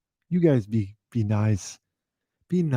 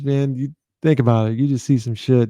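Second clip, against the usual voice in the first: ordinary delivery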